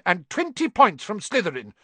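high, loud, fast scolding; furious stern teacher; angry shout. A narrator's reading. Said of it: "she shouted".

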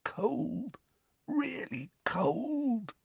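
high, soft, drawn-out whisper; weak, shivery croak. A narrator's reading. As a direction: whisper it slowly as if chilled. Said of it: "croaked Dudley".

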